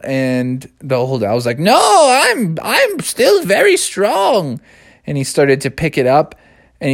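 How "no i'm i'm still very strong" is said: put-on wise old owl.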